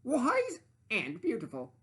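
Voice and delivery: high, slow vain interruption; high vain sandwich cutting in; interrupting sandwich. Acted. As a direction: cut in proudly, savouring it. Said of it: "cut in the sandwich".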